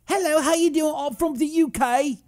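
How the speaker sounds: badly faked high British voice; terrible British accent. Host greets a viewer from the U K, bad British accent. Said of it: "Terrible accent. I'm, I'm the worst with accents".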